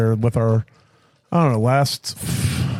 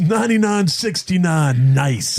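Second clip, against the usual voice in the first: slow radio station ID; 3 semitones higher, slower than usual. Pretend. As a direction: announce the station call sign. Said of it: "my F M radio voice".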